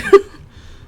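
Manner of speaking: sudden loud burst of laughter; quick amused laugh; short loud laugh. Real, not acted. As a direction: laugh at her own mistake. Laughing at swimsuit in rain.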